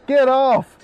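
high, loud, drawn-out protest; startled yell. Pretend. Player grabbed by a zombie in game, startled yell. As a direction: yell as if shoving an attacker away.

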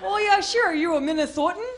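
super sweet Minnesotan lilt; Minnesotan accent. Retold or imitated. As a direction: round the O's like a Minnesotan. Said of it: "that super sweet accent".

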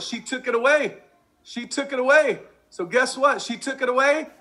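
high, gloating punchline; triumphant. Acted. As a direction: sell the payoff with glee.

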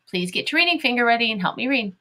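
ordinary talk, nothing marked

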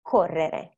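CORRERE with a long rolled double R; held double consonant. Shown as a demonstration. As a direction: hold the RR in CORRERE before moving on. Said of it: "extend the sound while holding".

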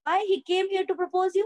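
high, slow astonished asking; a girl caught off guard by the news; surprised question. Acted. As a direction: ask it in disbelief, drawn out. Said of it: "उसको surprise होके पूछती है".

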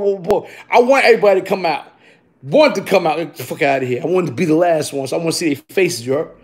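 ordinary talk, nothing marked

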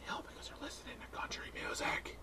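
hushed sinister whisper. Acted. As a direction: whisper it like a secret verdict. Sinister aside.

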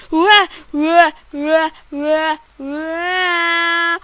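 loud, high siren wail "ue, ue"; police siren imitation. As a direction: mimic a police siren at full volume. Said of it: "O, poliția". (imitație de sirenă de poliție, tare și ascuțită)

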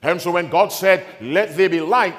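loud, high, driving sermon delivery; full-force preaching. Real, not acted. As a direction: thunder the scripture out to the congregation.

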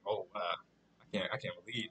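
soft, low, slow imitation; mock disbelief. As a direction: murmur it low and hesitantly, as a quote. Imagining the annoyed man's reaction.

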